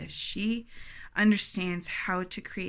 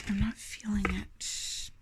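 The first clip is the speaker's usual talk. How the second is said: whisper; 4 dB quieter, slower than usual. Real, not acted. Unsure which card to read.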